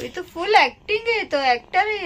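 amused exclaim. Real, not acted. Amused at cat acting.

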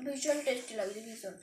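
chewing while talking; speaking between bites; mouth full of chips. Real, not acted. Seen 1: Lips pursed, jaw working, holding a bitten chip while speaking.

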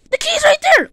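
high, loud exclamation; startled discovery. Pretend. Spotting the key, startled discovery.

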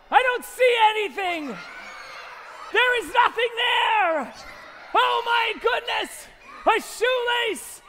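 high, loud incredulous protests; hammed-up clueless disbelief; exaggerated bafflement. Magician can't find what kids point at, high and incredulous.

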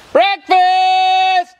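high, loud, drawn-out breakfast call. Real, not acted. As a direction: holler it to everyone, stretching the last syllable. Calling family to the meal.

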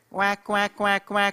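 high, loud quacking duck; duck quack imitation. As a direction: quack like a well-behaved duck. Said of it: "als ik een eend zou nadoen".

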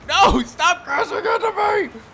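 high, loud, drawn-out protest; frustrated shout. Real, not acted. Player telling others to stop crashing, frustrated shout. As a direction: yell it slowly in real exasperation.

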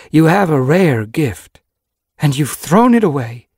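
loud, high scolding, leaning on the words; heated reproach. A narrator's reading. Sage Whisker blaming Yellowfang for a wasted gift, scolding loudly. Mentor scolding her apprentice.

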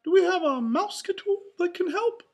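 high, loud, fast mouse falsetto; Mickey. A narrator's reading. Mouse asking his helper for a tool, quick squeaky voice. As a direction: ask eagerly in a squeaky cartoon voice. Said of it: "Mickey said".

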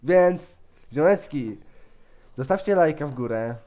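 loud, lively channel-host patter; YouTuber intro. Pretend. Host asking viewers for likes and subs, loud YouTuber patter. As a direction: pitch the video to your viewers.

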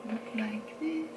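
usual speaking voice

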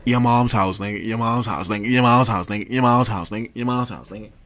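rapid-fire repeated comeback in bursts; loud, punchy, looping taunt; taunting repetition. Pretend. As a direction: chant it over and over as a taunt. Mock answer to address request, repeated.

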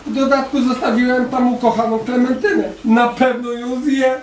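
a touch of storybook sobbing; upset little girl. A narrator's reading. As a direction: fret like a girl afraid for her doll.

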